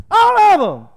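high, loud punch on all; emphatic shout. Real, not acted. Preacher answering his own question with a loud shout. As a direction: belt it out as the obvious answer.

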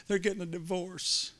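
a hint of a sad child, spoken gently; little girl voice. Girl voiced, higher and softer.

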